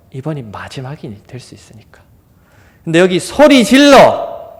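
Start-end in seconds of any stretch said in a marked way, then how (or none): 0.1-2.0 soft hushed whisper; preacher whispering the reason to the congregation; whispered aside. Real, not acted. 3.2-4.5 high, loud, slow drawn-out cry; crying out louder. Retold or imitated. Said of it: "이것도 미완료형이에요".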